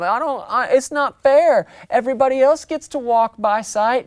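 high whining protest; whiny kid. Acted. Believer whining to God like a child. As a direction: complain like a child who wants his way.

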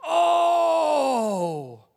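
sudden loud, high shout of alarm; team's shout. Retold or imitated. Preacher voicing the teammates' shocked yell, loud. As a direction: yell it out like a whole team saw a crash. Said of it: "I hear all the other basketball team go".

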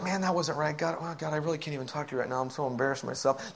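low, quick, sheepish mumble; shamefaced private muttering; embarrassed regret. Acted. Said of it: "And then you're like".

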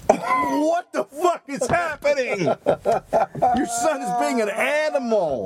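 slow, high, stunned disbelief; incredulous disbelief. Retold or imitated. Wife's reaction to the smug dad, in slow high disbelief. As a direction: drag it out high, can't believe it. Incredulous outburst about son.

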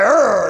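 gruff growl. Retold or imitated. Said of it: "I was like".

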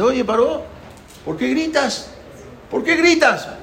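high-pitched, insistent question; acted challenge. Moshe asks Pharaoh why he shouts, high challenge. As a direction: ask it high, calling across to him.

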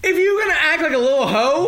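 yelling into the mic; too loud for the mic, the sound distorts; clipping distorted mic. Real, not acted. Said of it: "Stop yelling into the mic".

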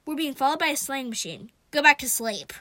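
loud, brusque shout; loud exasperated order. A narrator's reading. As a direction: shout the bad news, then dismiss them. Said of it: "I shouted".

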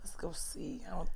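hushed unvoiced aside; whisper. Real, not acted. Co-host whispering an aside to a friend. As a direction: whisper it so the mic misses it.

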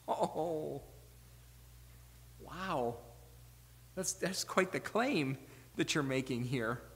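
quiet, acted awe; stunned reaction. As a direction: react softly, as if floored by the claim.

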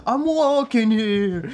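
loud, very slow New York shout; New York yell. Retold or imitated. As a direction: drag it out, yelling at a cab. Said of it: "il fait". (cri new-yorkais très traînant)

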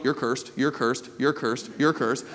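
low, soft mock condemning Jesus; lightly mocking repeated curse. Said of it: "didn't sit there and go".

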